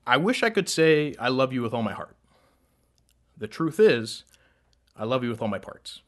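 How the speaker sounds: rhythmic, building slam delivery; spoken word poem. Acted. Poet reciting a love poem to a crowd, spoken word. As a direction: perform a love poem on stage.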